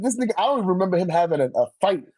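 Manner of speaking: usual speaking voice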